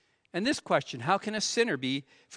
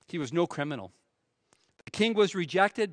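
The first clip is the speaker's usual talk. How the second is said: ordinary delivery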